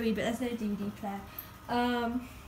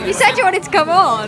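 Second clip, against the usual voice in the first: loud, higher teasing jab; playful protest; 8 semitones higher, 15 dB louder than usual. Real, not acted. Teasing her friend who joined the vlog, loud and playful.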